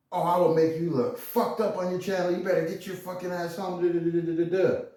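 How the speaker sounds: put-on angry girlfriend. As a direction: ham up her threat in a put-on high voice. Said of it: "And she's all".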